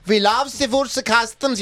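put-on light German accent, slow; a hint of a German accent. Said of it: "feeling very German tonight".

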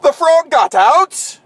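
high, loud panicked shout; frantic scientist. Acted. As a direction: shriek it out in alarm.

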